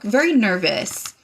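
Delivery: usual speaking voice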